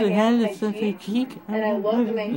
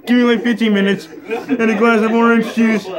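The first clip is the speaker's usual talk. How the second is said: laughing voice; 3 semitones higher, 6 dB louder than usual. Pretend. Voicing a drained Spider-Man.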